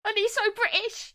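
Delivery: mock British gushing. Gushing about the British boy, squealing mock British. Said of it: "God blimey, governor!".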